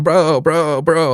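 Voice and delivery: high, drawn-out mocking chant; mock bro-speak. Podcaster mocking his co-host's constant lingo, high mock bro-speak. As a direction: imitate someone endlessly saying bro.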